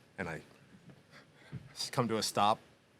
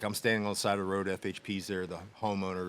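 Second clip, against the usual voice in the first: ordinary talk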